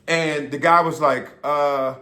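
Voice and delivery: normal talking voice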